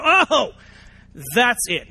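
loud, high sudden "oh"; the lightbulb switching on; realization. Pretend. Listener finally getting it, loud high surprise.

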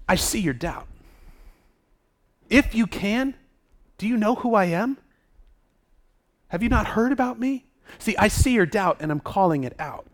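softly spoken, measured challenge; quiet challenge. Acted. Jesus calling out the father's doubt, quietly challenging. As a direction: confront the doubt without raising your voice.

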